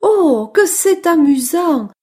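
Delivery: high, excited mouse voice; delighted little mouse. Acted. Mouse enjoys dancing. (petite souris ravie qui s'amuse)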